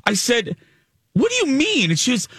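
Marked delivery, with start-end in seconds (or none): 1.1-1.9 retold baffled question, pitched high; mock bewilderment, voice jumping up. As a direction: act out a puzzled, high-pitched reply. Baffled reply to Hanson's odd question.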